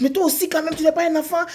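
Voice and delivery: high-pitched scolding of a forgetful friend. Acted. People scolding the forgetful one.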